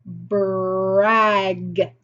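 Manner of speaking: "Brag" stretched out with a long held r; slow, blended "Brag" for a phonics class; word on a curve. Shown as a demonstration. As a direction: draw out each sound of "Brag" in one glide. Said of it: "Brag is the word on the curve".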